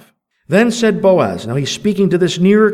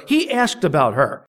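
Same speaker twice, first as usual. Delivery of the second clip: usual speaking voice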